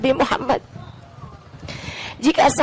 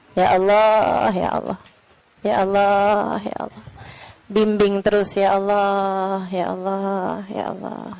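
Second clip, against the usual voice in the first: fervent whispered prayer; 6 semitones lower, 3 dB quieter than usual. Real, not acted. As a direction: beg Allah softly, letting each ya Allah die away. Pleading prayer, slow, fading.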